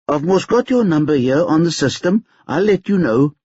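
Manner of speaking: a hint of a busy clerk; lightly voiced admin auntie; auntie's voice. A narrator's reading.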